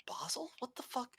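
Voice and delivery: whispered, baffled thinking to himself; imitated inner thought. Dad silently puzzling over a customer's dog name, baffled whisper. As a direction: mouth it as a hushed, puzzled thought. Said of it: "you know, subconsciously, he's like".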